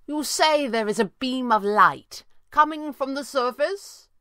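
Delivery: high, carrying question; calling out. A narrator's reading. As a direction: call a question up to someone above. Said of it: "he called".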